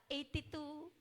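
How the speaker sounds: lightly voiced imitation of Pia, soft and rising; a hint of Pia calling out the number; imitating Pia's voice. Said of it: "alam mo yung boses ng". (ginagaya ang boses ni Pia, mahina)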